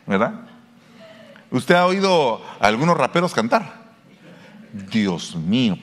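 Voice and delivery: ordinary delivery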